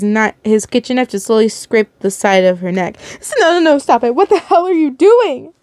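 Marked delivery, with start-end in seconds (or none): 3.2-5.5 high, panicked protest; horrified cry. A narrator's reading. Daughter to the man hurting her mother, horrified. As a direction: cry out to make it stop. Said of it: "You cried out in horror".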